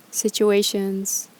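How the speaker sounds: calm, unhurried meditation guide with long pauses; guided meditation. Acted. Yoga teacher guiding followers through gratitude reflection, calm meditation voice. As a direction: ease listeners through each breath, leaving space between phrases.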